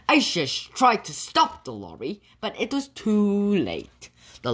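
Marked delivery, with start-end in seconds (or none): none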